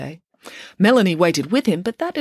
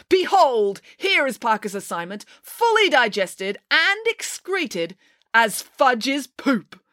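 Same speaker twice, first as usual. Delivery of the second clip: high, theatrical announcement, drawn out later; grand proclamation; 8 semitones higher, slower than usual. A narrator's reading. Friday unveils the bag to the class, proclaiming. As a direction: proclaim it like a showman's reveal.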